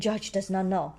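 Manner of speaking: plain reading voice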